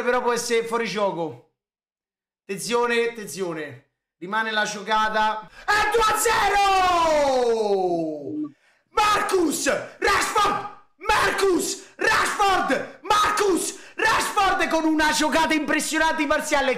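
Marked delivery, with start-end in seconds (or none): 5.7-16.8 goal celebration shout. Real, not acted.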